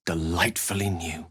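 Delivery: a secret breathed to a friend; whispered. Acted. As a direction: whisper it, delighted. Intimate aside to companion.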